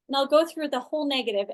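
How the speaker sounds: ordinary delivery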